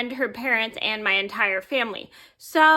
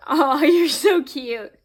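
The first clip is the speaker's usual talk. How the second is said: slow, louder cooing aside; real adoring coo with a little laugh; cooing affection; 3 semitones higher, 5 dB louder, slower than usual. Real, not acted. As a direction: gush over a cute pet, drawing it out. Cooing at Humphrey mid-story.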